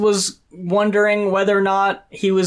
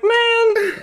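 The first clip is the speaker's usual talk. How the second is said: gushing delight. Real, not acted. Gushing over lyric.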